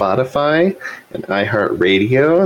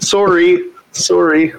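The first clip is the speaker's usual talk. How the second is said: Canadian sorry; 8 semitones higher, slower than usual. Acted. Mock Canadian apologizing.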